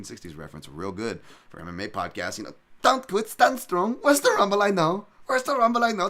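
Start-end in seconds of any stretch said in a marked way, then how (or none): 2.8-6.1 put-on very high, loud Dutch-accented corner yell; Henry Hooft Dutch accent. Cornerman shouting at Rumble Johnson, thick Dutch accent. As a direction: bark it like a Dutch trainer between rounds. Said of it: "I just love that Henry Hoof accent".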